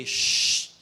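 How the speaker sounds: unvoiced snake shush; snake hiss. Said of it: "Sabi naman nung ahas".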